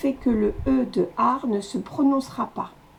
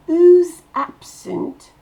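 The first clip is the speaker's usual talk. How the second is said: "Who's absent?" higher, louder and slow; slow emphatic demo; 7 semitones higher, 9 dB louder, slower than usual. Shown as a demonstration. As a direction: hammer each word out slowly. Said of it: "c'est ab qui explose". ("Who's absent?" lent et appuyé)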